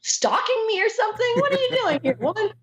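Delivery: high, quick comic accusation; mock alarm. Actor accusing a fan of stalking her, mock alarm. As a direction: squeal the questions in pretend outrage.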